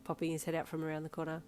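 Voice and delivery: ordinary delivery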